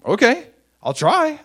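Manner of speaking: new believer answering God eagerly; eager high agreement. Retold or imitated. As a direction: jump at it eagerly. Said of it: "I was like".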